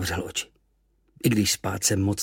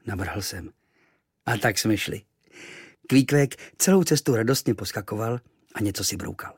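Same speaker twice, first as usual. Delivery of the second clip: plain reading voice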